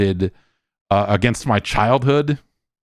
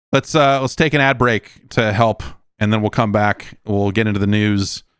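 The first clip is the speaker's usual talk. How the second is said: normal talking voice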